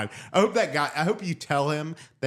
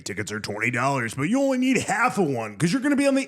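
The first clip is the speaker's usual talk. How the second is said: monster truck ad. Pretend. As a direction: hype the rally like a radio spot. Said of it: "Are you sure it shouldn't sound like".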